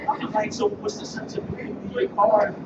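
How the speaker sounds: high, loud, exasperated complaint; sudden loud frustration at being ignored; indignant outburst. Real, not acted. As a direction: throw it out like a grievance. Indignant about unanswered call.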